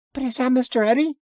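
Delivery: put-on high, curious child voice; little girl's voice. A narrator's reading. Daughter asking her father about the stranger, high child voice. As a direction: ask like a small girl peering past her dad.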